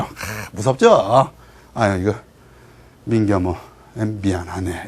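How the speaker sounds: very low, soft, halting mumble; cowed king. Acted. Frightened king abandoning his minister, cowed. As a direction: back down haltingly, voice sinking.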